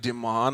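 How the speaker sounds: "Demon" as dih-MON; fancy name variant. Shown as a demonstration. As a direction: put the weight on MON in "Demon". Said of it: "That's kinda cool".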